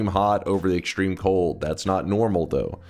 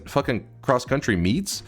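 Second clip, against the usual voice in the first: usual speaking voice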